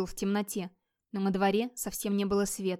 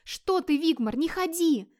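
high, drawn-out frightened plea; terrified, high-pitched exclamation; horrified cry; 8 semitones higher, 3 dB louder, slower than usual. Acted. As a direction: cry out in horror to stop them. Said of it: "в ужасе вскрикнула Эльдис".

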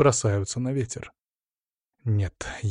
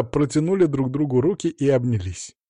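ordinary delivery, nothing marked

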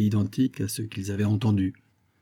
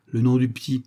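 ordinary delivery, nothing marked

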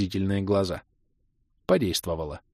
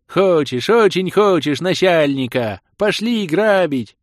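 eager shout; 8 semitones higher, 6 dB louder, slower than usual. Acted. Half-orc eager to rob.

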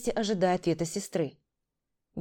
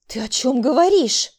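loud, raised-pitch appalled question; horrified; 5 semitones higher, 8 dB louder than usual. A narrator's reading. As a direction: ask it aghast. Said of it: "С ужасом в глазах проговорила она". (с ужасом)

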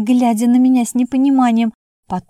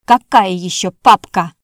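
louder, irritated demand; angry; 2 semitones lower, 6 dB louder than usual. A narrator's reading. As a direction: snap it out, annoyed. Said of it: "тут же разозлилась". (раздражённо, громче)

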